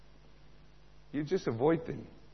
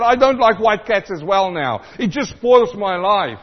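whiny complaint; 6 semitones higher, 11 dB louder than usual. Retold or imitated. Voicing sulky young man.

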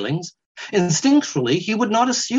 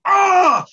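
much higher, loud sudden cry; startled weasel shout; 6 dB louder than usual. Acted. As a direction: yelp at a blinding light. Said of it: "shouted the weasel".

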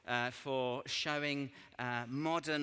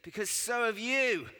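high, loud mock-horrified reveal; hammed-up outburst; exclaimed punchline; 10 semitones higher, 7 dB louder than usual. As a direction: hit them with the dreadful twist. Friend's climactic punchline.

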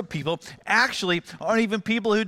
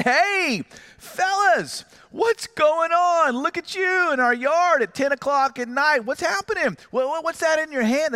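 calling out a confrontation; 9 semitones higher than usual. Retold or imitated. Dad calls out to teens caught in his yard at night, high mock-friendly confrontation. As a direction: re-enact hollering across the yard, pitched way up.

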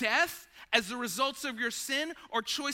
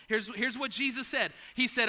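ordinary delivery

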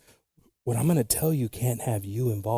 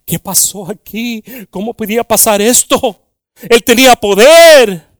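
disciples in disbelief; 8 semitones higher, 14 dB louder than usual. Retold or imitated. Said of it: "ellos estaban todos".

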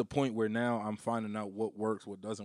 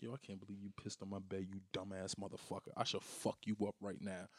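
muttered threat; 3 semitones lower, 13 dB quieter than usual. Retold or imitated. Quietly retold threat to child.

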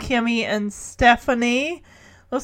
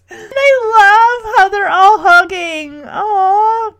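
high, loud, real gushing; touched gushing; 8 semitones higher, 7 dB louder, slower than usual. Real, not acted. Host moved by how they're all hugging, touched gushing. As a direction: melt out loud over something sweet. Gushing over the family hug.

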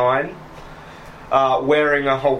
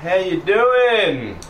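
put-on high, slow friendly old Cockney greeting; Dougie's greeting; 6 semitones higher, much slower than usual. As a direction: drag out a cheery hello as the old thief.

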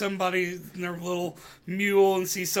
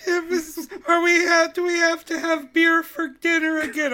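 put-on high whiny little kid; child voice; 10 semitones higher, 4 dB louder than usual. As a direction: ask like a worried kid. Child voice asking father.

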